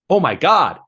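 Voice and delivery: put-on loud, high Minnesota accent exclamation; thick Minnesota accent. Scott stunned after floored sparring, in a loud Minnesota accent. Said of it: "in a thick Minnesota accent".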